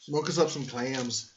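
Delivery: hammed-up Boston accent with dropped R's; mock Boston accent. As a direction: put on a broad Boston accent for a joke. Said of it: "J F K Boston accent".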